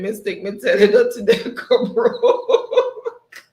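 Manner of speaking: loud, slow read broken by laughter; laughing. Real, not acted. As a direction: laugh your way through the line. Reading chat, cracking up.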